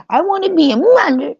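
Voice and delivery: very high, exaggerated yearning imitation; mock wannabe mother. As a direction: parody a woman pining for motherhood. Mocks women longing for motherhood.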